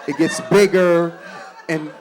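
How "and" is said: normal talking voice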